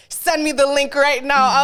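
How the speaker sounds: loud, slow mock mom demand. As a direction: insist like a bossy mom.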